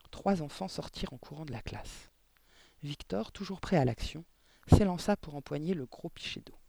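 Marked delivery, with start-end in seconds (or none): none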